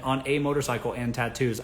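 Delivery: usual speaking voice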